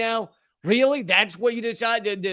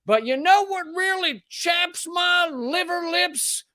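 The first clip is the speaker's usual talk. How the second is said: high, slow Southern drawl mocking a bigot; exaggerated Southern accent, mocking; mock Southern yokel; 11 semitones higher, slower than usual. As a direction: play a clueless Southern bigot, drawn out. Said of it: "you're like".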